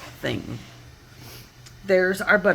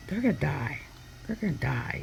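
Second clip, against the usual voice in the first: hushed, tense mock whisper; mild imitated muffled dread; hushed panic; 2 semitones lower, 8 dB quieter than usual. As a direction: murmur it like a hand is over the mic. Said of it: "covering the mic".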